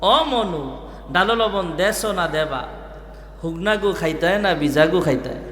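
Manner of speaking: playful Barisal dialect call. Retold or imitated. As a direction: lightly mimic a Barisal villager calling out. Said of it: "এরা মজার একটা ডাক আছে".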